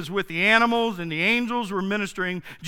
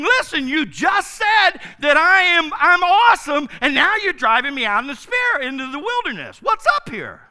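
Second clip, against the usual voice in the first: high, loud exasperated outburst as Jesus; indignant protest; 7 semitones higher, 6 dB louder than usual. Retold or imitated. Jesus protesting his drive into the wilderness, exasperated shout. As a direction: demand answers, louder and higher. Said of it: "I would be thinking".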